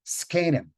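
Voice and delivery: Skenim with an unvoiced S before the K; Yiddish pronunciation. Shown as a demonstration. Teacher shows the Yiddish sound: Skenim with an S. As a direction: start Skenim with a hissed S, no vowel. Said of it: "it's pronounced S K".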